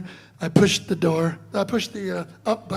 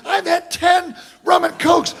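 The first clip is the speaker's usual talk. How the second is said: mock shout; 7 semitones higher, 4 dB louder than usual. [name] retorts to refusal.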